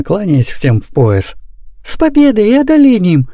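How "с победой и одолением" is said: high, triumphant proclamation; solemn announcement. A narrator's reading. Nanny bowing to all with news, solemn high proclamation. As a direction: proclaim it grandly in a raised voice. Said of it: "говорит она торжественно".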